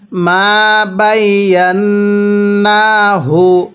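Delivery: slow, drawn-out tajweed chanting; slow Quran recitation. Shown as a demonstration. Teacher demonstrating recitation to students, slow chant. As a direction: recite each syllable long and measured. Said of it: "কিভাবে পড়ব?".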